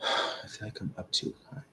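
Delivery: very soft, half-voiced after a sigh; sigh, then murmur it to yourself; barely voiced private aside; talking to self. Real, not acted. Searching to himself after a sigh.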